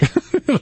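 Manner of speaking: quick high laugh. Real, not acted. Laughs at own story.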